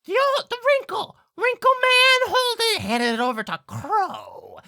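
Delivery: very high, loud bird screech; insistent blackbird. Acted. Blackbird answering who paid, loud high screech.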